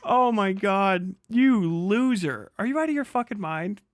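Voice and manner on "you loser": ordinary talk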